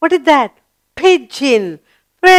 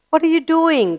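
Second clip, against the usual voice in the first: light, higher little crow voice; 7 semitones higher, 4 dB quieter, faster than usual. Acted. Teacher voicing a crow for children, lightly raised. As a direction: ask it like a curious little bird, pitched up. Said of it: "the crow asks".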